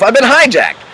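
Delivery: high, loud, laughing shout of the words; playful yell. Retold or imitated. Coach showing listeners how to yell it, loud and high. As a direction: yell it out to break the spell. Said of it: "So I said to myself".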